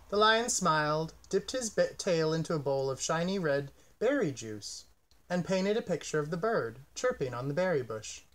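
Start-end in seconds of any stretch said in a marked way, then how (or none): none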